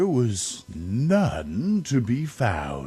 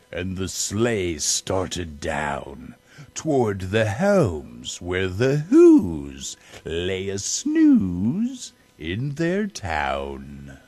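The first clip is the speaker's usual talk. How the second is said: plain reading voice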